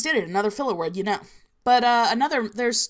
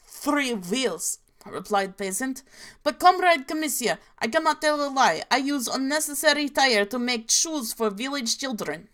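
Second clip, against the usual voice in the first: hammed-up Russian accent, pitch jumping for the characters; cartoonish Russian accent; 3 semitones higher than usual. Telling of commissar and peasant, cartoonish Russian accent. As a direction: Soviet fable in cartoon Slavic English. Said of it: "cartoonishly Slavic".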